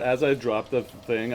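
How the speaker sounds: ordinary talk, nothing marked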